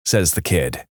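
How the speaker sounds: ordinary talk, nothing marked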